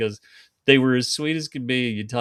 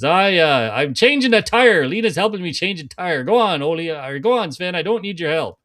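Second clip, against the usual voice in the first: higher, louder Minnesota protest; Ole's Minnesota accent; 6 semitones higher, 5 dB louder than usual. Acted. Caught man refusing help, thick Minnesota accent. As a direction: brush him off in broad Minnesotan. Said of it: "they literally had the thick Minnesota accents".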